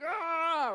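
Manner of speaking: loud, high burst of a frustrated yell; frustrated scream. Retold or imitated. Pastor retelling freaking out in the library parking lot, loud frustrated yell. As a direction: let out an exasperated cry.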